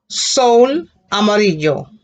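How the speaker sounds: sol amarillo drawn out slowly and clearly; slow model word. Shown as a demonstration. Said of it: "you said sun yellow".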